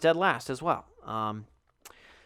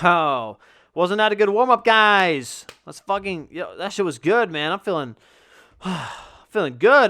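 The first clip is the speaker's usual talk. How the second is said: loud, higher, forced cheer while winded; forced hype; 4 semitones higher, 9 dB louder than usual. Retold or imitated. To the team after the warm-up, loud fake cheer. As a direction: cover the exhaustion with loud bravado.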